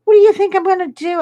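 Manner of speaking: high, loud, rapid-fire retort; indignant protest. Retold or imitated. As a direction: act out an affronted husband protesting. Retold husband protesting to officer.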